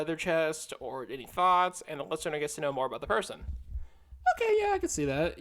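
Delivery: ordinary delivery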